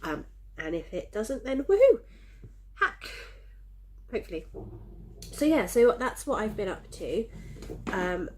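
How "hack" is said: ordinary delivery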